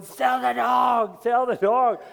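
loud, slow, high-pitched shouts of urging. Retold or imitated. Pastor retelling yelling at a dog video, urging loudly. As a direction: yell at the screen, begging them to sell. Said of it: "I'm watching the video going".

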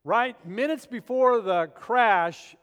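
ordinary talk

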